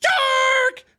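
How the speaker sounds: high, far-off yelp of fright; scared shout. Retold or imitated. A kid in the movie theater terrified, shouting out loud. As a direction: yell it as the head pops out. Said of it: "and I went".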